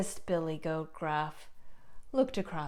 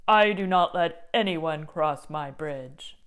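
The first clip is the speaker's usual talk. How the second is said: mean troll; 7 dB louder than usual. Acted. Troll warning everyone off his bridge, loud. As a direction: announce yourself as the bridge's boss.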